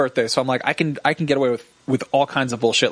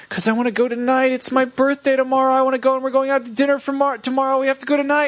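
put-on high, childish whining insistence; whiny demand; 12 semitones higher than usual. Begging Jen to go to the comic shop tonight, high whine. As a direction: whine like a kid who wants his way.

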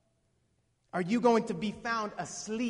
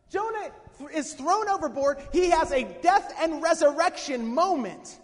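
ordinary delivery

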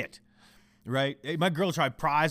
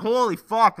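loud, high exclamation of disbelief; fed-up re-enacted yell; exasperated outburst; 6 semitones higher, 10 dB louder, slower than usual. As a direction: burst out at the nagging cat. Exasperated at the yowling cat.

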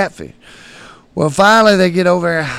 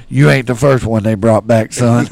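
lower, firm retold old-timer; old man deadpan; 4 semitones lower, 6 dB louder than usual. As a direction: deliver it low and unimpressed, like an old man. Old man's quote, lowered voice.

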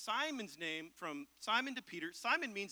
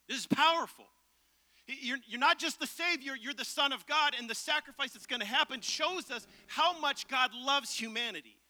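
higher and louder, driving the point home; full-force preaching; 5 semitones higher, 7 dB louder than usual. Acted. Pastor proclaiming to the congregation, full-force preaching.

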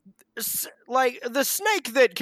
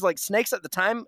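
normal talking voice

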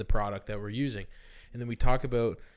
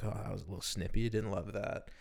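inner thought; 4 semitones lower, 10 dB quieter than usual. Retold or imitated. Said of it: "I was like".

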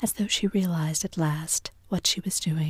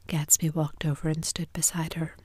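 usual speaking voice

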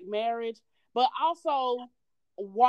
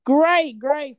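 upbeat; 4 semitones higher, 10 dB louder than usual. Real, not acted. Guest answers host's greeting.

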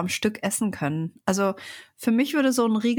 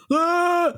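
short playful yell; mock scream; 8 semitones higher, 4 dB louder than usual. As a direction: give a high little yell. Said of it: "der schreit so oder macht so".

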